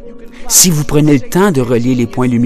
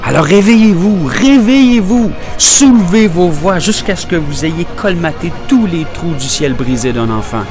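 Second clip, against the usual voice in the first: rousing cry; 8 semitones higher than usual. Acted. Educator rousing listeners to raise their voices, impassioned cry. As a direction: cry out to rouse the whole room. Wake-up call at poem's climax.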